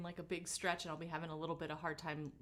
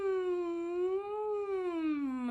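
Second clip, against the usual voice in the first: high buzzing hum; 9 semitones higher, 4 dB louder than usual. Shown as a demonstration. Teacher humming to show lip buzz, high. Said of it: "feel the front of your lips buzzing".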